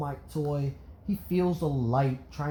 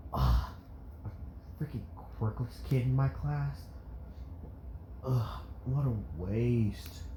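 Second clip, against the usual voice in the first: disgusted teacher; 2 semitones lower, 5 dB quieter than usual. A narrator's reading. Teachers hear he is quirkless, disgusted mutter. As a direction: sneer it under your breath. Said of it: "had a face of disgust".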